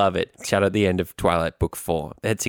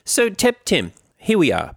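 show host voice; 9 semitones higher than usual. Acted. Host opening post-show.

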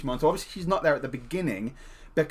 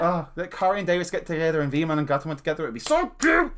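gushing fan. Retold or imitated. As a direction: rush the wish, then squeal in delight. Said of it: "And I was just like".

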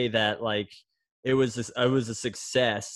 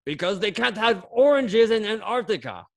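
high Russian coach punchline; Coach Kirilov Russian accent; 9 semitones higher, 4 dB louder than usual. Retold or imitated. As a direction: land the punchline like the old Soviet coach. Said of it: "your Russian accent".

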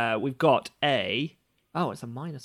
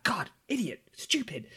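hissed under breath; whispered self-cursing; 7 semitones higher, 6 dB quieter than usual. Acted. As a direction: curse yourself in a whisper. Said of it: "He's like".

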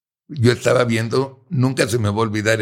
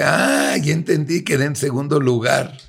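exclaimed much higher, half-shouted; a sudden, sarcastic penny-drop; mock realization; 7 semitones higher than usual. Realizing he lost the pitch. (exclamación sarcástica de sorpresa, tono mucho más alto)

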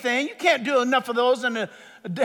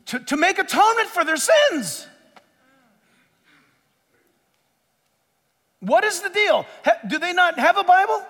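high, insistent preacher's voice; full-force pulpit plea; impassioned preaching; 7 semitones higher, 5 dB louder than usual. Real, not acted. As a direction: drive it home to the congregation, high and forceful.

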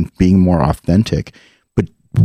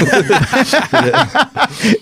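high laugh. Real, not acted. Big laugh at Thavage story.

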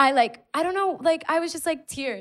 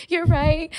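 high, loud tearful yes; emotional agreement; 10 semitones higher, 6 dB louder than usual. Retold or imitated. Agreeing with the cop through tears, high and loud. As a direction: blurt it out, pitched high and louder.

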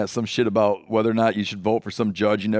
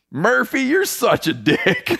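high, slow mock taunt; mocking heckler; 12 semitones higher, much slower than usual. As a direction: sneer it up high and drawn out. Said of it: "It's a great quote".

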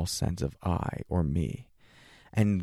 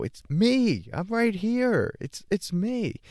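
high squeaky mock insistence; mock protest; 13 semitones higher than usual. The ego insists to the meditator, in a high mock voice. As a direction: play the indignant ego, pitched way up. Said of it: "And we go".

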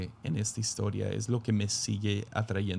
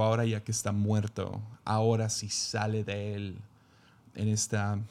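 ordinary talk, nothing marked

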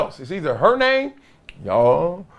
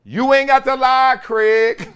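movie quote; 8 semitones higher, slower than usual. Retold or imitated. Host voicing what she should have said to Wilbon. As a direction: deliver the famous line to Wilbon. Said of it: "She should have said to Wilbon".

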